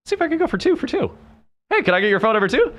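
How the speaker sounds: high, quick, playful pick-up re-enactment; cheeky chat-up. Retold or imitated. Going for two for two with the next bartender, cheeky. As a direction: pipe up eagerly, pushing your luck.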